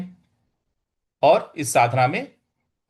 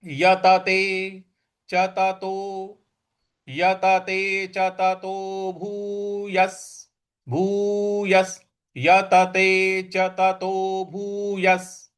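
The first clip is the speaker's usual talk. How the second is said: slow, every syllable clear; slow recitation; much slower than usual. Shown as a demonstration. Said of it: "तो पूरा बोलना है इसको". (धीमा स्पष्ट पाठ)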